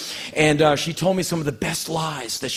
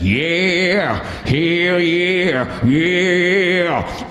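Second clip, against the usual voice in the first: higher, louder mock rock-singer growl; 4 semitones higher, 4 dB louder, much slower than usual. Mocking the identical modern rock singer, hammed up. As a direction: ham up the generic rock singer. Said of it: "who does that".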